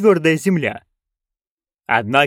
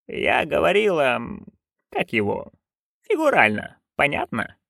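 soft, halting search for a word; hesitant; 5 dB quieter than usual. A narrator's reading. Witch explaining after awkward pause, hesitant. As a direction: falter with pauses, then get the word out.

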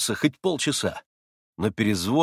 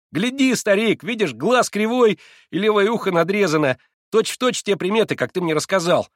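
eager explanation; 7 semitones higher, 4 dB louder than usual. A narrator's reading. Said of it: "пояснил он, быстро оборачиваясь".

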